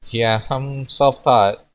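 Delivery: bashful hesitant delivery; nervous. Acted. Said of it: "Ryoga grinned nervously".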